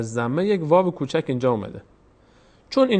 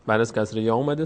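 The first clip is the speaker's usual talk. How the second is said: ordinary talk, nothing marked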